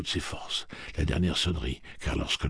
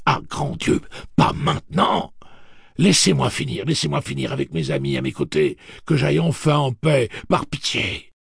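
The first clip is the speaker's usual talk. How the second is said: desperate pleading; 10 semitones higher, 7 dB louder than usual. Acted. Praying not to die yet.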